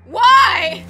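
loud, high, exasperated yell; exasperated shout. Real, not acted. Player puzzled by an arrow, exasperated shout. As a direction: bellow it in frustration. Game action keeps failing.

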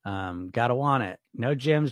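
usual speaking voice

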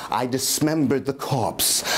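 ordinary delivery

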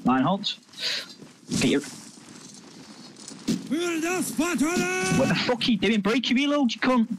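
steady orders snapping into a high yell; calm turning angry. Real, not acted. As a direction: start level, then blow up. Commander loses patience with player.

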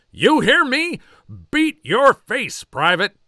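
very high, loud screaming order; loud, slow drill sergeant scream; furious drill sergeant. Acted. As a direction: scream it in his face.

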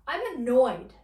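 loud irritated declaration; acted annoyance. Shown as a demonstration. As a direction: push out annoyed with force. Acting out the word annoyed.

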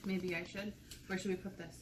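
usual speaking voice

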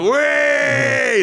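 loud high triumphant whoop; loud whoop on walking back in; cheering shout. Retold or imitated. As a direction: burst in cheering.

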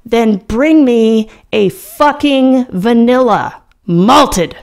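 furious roar at the waitress. A narrator's reading. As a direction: bellow the order, drawing it out. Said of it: "I roar".

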